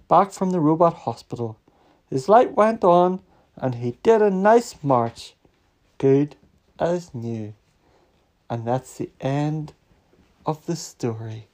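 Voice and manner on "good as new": usual speaking voice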